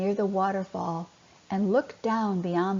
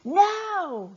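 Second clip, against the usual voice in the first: high, loud urgent shout; shouted warning to flee the turtle; 8 semitones higher, 5 dB louder than usual. Acted. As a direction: yell at them to flee. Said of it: "he shouted".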